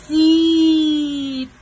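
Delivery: loud, long-held zip; playful command. Real, not acted. Parent telling baby to close mouth, loud drawn-out command. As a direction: stretch it out loudly like closing a zipper.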